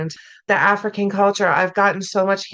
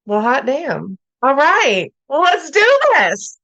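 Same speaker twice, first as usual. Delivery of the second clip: delighted exclamation; 5 semitones higher, 3 dB louder than usual. Retold or imitated. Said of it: "I specifically love the, "Well, hot damn".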